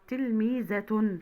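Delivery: slow تلميذة ending in -tun; تنوين بالضم. Shown as a demonstration. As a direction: draw out تلميذة to a final -tun. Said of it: "وبالضم". (نطق تلميذة ببطء بضمتين في آخرها)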